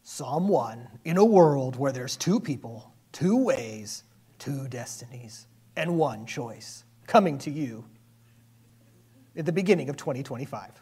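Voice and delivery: dramatic trailer announcer, slowing down; movie trailer voice. Pretend. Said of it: "It almost sounds like a movie trailer".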